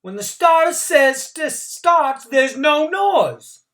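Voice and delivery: loud, high angry snap, slowing down; snarled. A narrator's reading. Starter Bump snapping at the noisy crowd, angry. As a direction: tell the crowd off. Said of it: "Bump turned and snarled".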